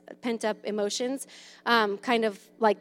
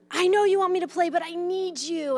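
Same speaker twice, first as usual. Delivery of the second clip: mock clingy parent; 7 semitones higher than usual. Parent acting clingy to child.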